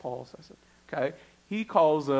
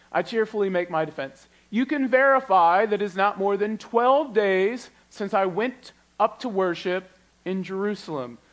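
lifted Bible reading voice; scripture reading; 5 semitones higher, 5 dB louder than usual. Acted. Pastor reading Paul's reply to the governor, lifted. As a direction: read Paul's defense aloud with lift.

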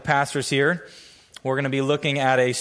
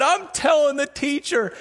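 put-on tattling kid; 12 semitones higher than usual. Bully threatens to tattle.

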